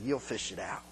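soft, low deadpan remark; dry quiet reply. Retold or imitated. Husband quietly telling his angry wife she'll fetch it back, deadpan. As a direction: say it quietly, knowingly. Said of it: "I just looked at her and said".

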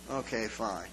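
low, soft, resigned reply; muted, reluctant agreement; grudging acceptance. Retold or imitated. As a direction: give in quietly, dropping the pitch. Grudging reply to second usher.

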